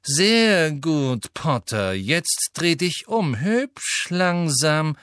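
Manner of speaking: cold drawling villain behind them. A narrator's reading. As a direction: savour each word, stretching it. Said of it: "sprach eine gedehnte Stimme".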